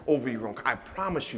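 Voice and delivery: normal talking voice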